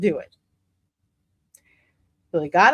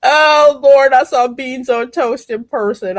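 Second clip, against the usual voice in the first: louder, disbelieving outburst; loud exclamation; 3 semitones higher, 9 dB louder than usual. Real, not acted. Vlogger recalls beans on toast, loud horrified outburst. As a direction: cry out in horror at beans on toast.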